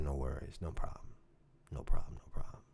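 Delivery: quiet resigned murmur; letting it slide. Retold or imitated. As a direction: swallow it and murmur it to yourself. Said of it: "I let that slide".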